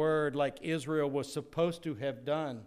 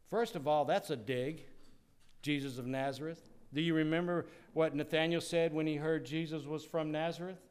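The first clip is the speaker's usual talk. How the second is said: ordinary delivery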